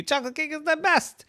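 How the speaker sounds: put-on high joyful girl's voice; delighted little girl. A narrator's reading. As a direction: gush like a child seeing her cake. Yvette delighted.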